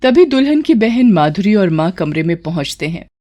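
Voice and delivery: normal talking voice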